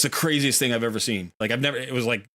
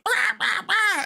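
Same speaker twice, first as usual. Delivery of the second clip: very high shrill mock caws; imitating crows screaming at the kill; slower than usual. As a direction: caw like startled crows. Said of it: "And all of the crows went".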